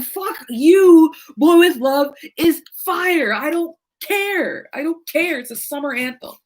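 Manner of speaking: retold heated retort. Retold playful argument with friend.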